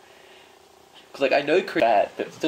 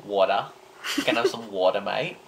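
Aussie accent; 2 semitones lower than usual. Shown as a demonstration. Friend asks for the water thing, broad Aussie accent. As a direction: show off your Aussie voice on request. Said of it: "Do the water thing again".

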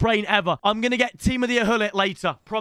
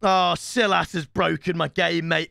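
ordinary delivery

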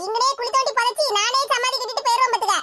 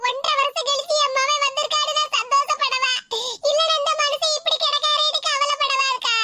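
tearful lament; 4 semitones higher than usual. Acted. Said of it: "ஏ கமலா, அழாத".

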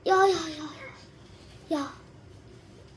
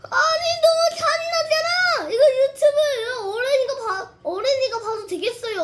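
higher, louder, shocked protest; outraged shout; 8 semitones higher, 4 dB louder, faster than usual. Real, not acted. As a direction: yell it in disbelief. Outraged at gory game scene.

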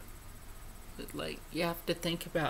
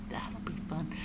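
soft whisper; whispered tease; 2 semitones lower, 8 dB quieter than usual. Real, not acted. Teasing upcoming paintings, whispered. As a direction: breathe it like a secret.

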